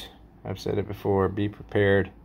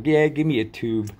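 big dealer voice; 4 semitones higher than usual. Retold or imitated. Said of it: "in your voice and accent".

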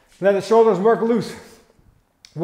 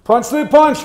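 higher, loud rhythmic combo call; shouted command; 5 semitones higher, 6 dB louder than usual. Real, not acted. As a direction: bark out the combo. Coach calling out combos.

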